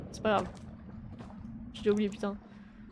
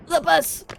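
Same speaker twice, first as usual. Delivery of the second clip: triumphant cry; 7 semitones higher, 10 dB louder than usual. Real, not acted. Makes the jump.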